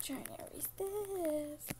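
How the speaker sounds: soft slow whisper. Real, not acted. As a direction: whisper it under your breath. Aside while erasing.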